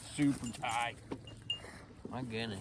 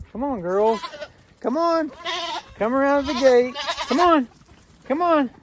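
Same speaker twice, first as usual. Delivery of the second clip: high, raised call to the goats; calling animals; 12 semitones higher than usual. Real, not acted. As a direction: coax them round the gate.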